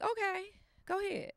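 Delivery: high, coaxing sing-song; coaxing grandma. Retold or imitated. Grandma coaxing grandson in the water, high and coaxing. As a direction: gently urge a little boy. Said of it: "I'm like".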